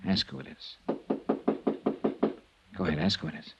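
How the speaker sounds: hushed urgent command. Acted. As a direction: keep it low, pressing him. Detective coaching in hiding.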